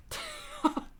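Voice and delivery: high burst of laughter; sudden laugh. A narrator's reading. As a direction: let a real laugh break out. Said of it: "that does make Dave laugh".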